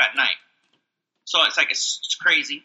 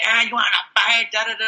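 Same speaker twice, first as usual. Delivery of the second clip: high-pitched blustering challenge; mocking imitation; 6 semitones higher than usual. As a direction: mimic a coworker itching to fight. Mimics coworker picking a fight.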